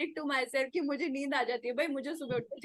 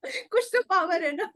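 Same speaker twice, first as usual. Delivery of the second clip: laughing; 8 semitones higher, 7 dB louder, much faster than usual. Real, not acted. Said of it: "मैं चीखती हूँ".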